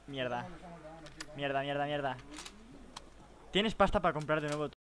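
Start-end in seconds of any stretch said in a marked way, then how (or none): none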